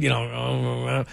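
lightly voiced Sling Blade character. Shown as a demonstration. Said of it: "a little bit of Sling Blade".

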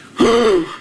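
mock shocked gasp. Listener hearing juicy gossip, mock shocked gasp. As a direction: gasp as if scandalized.

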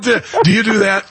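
usual speaking voice

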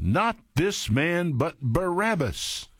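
slow, high crowd cry; mock crowd calling for Barabbas. A narrator's reading. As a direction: draw out the demand for Barabbas. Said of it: "they cried all again".

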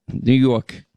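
imitating a drawn-out New York "Yawk"; mock the New York vowel in YORK; mimicked New York accent.

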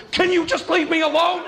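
put-on high-pitched exasperated kid outburst; retold yelling. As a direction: cry it out like a fed-up child. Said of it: "I yelled at her".

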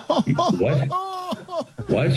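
a high, baffled double what; startled what. Retold or imitated. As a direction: squeak it like a confused sound man. Sound man caught off guard.

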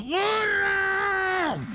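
long, very high squealed call; acted shout. Drowning ant calls the donkey, shouting. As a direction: stretch it out as a high cry for help. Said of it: "Y gritó".

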